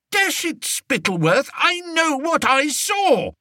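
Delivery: high, shouted protest; indignant shout. A narrator's reading. King shouting at disbelieving Spittleworth, indignant. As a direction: insist furiously. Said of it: "shouted the king".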